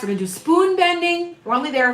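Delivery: high, bright-eyed announcement; excited. Real, not acted. Excited plug for the class.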